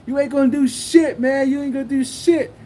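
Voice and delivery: high, insistent retold taunt; trash talk. Seller pointing in rival's face, high heated taunting. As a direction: jab at him, calling his bluff in reenactment. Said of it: "I was just talking shit to him".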